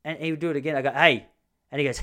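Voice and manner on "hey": sharp warning. Real, not acted. Father scolding toddler.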